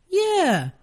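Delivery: high, smiling, falsely agreeable; fake innocence. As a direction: agree sweetly while needling. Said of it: "smile as innocently as I possibly can".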